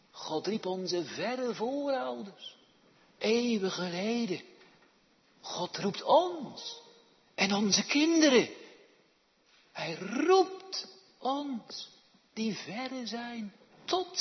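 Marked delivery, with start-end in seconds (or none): none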